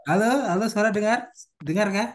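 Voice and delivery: loud hello into a choppy call. Real, not acted. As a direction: raise the voice to be heard. Calling out over a bad line.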